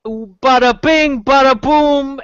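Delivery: loud, high mock Italian-American accent; silly Italian uncle. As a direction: punch it out like a cartoon Italian uncle. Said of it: "their silly Italian uncle".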